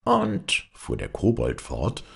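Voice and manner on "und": high, squeaky goblin. A narrator's reading. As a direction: pitch up into a tricky little kobold. Kobold voice in fairy tale.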